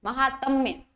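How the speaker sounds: usual speaking voice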